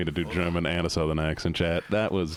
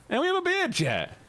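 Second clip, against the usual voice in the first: very high-pitched delighted squeal; excited. Real, not acted. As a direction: squeal the news to chat. Excited discovery of the new beard.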